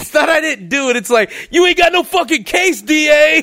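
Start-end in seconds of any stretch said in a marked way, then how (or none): 1.5-3.4 high, cocky taunt; mock triumphant gloat. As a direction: gloat at the prosecutor.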